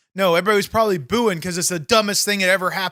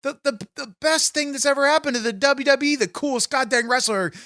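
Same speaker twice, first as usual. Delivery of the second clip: usual speaking voice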